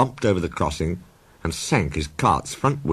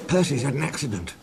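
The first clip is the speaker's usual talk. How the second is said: Toby voice, worried; 8 semitones higher, 3 dB quieter than usual. A narrator's reading. Toby reports accident.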